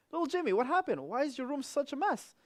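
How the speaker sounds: high, kind mother voice; a mother gently asking her son; mother's voice. Retold or imitated. Mother asking little Jimmy about the mess, voiced high.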